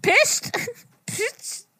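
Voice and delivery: high giggling try at the word; laughing squeak. Real, not acted. Laughing try at pronouncing the town name.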